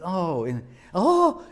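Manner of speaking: a high, thrilled exclamation at the deer; delighted gasp. Retold or imitated. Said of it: "and she said".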